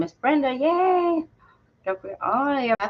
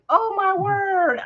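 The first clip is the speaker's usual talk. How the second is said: retold surprise; 11 semitones higher, 3 dB louder, slower than usual. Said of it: "I'm like".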